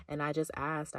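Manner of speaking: ordinary talk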